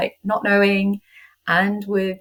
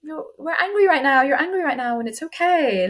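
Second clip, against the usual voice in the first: gentle, higher soothing voice to herself; tender; 6 semitones higher than usual. Retold or imitated. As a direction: comfort yourself like a little one. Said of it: "patient and tender with myself".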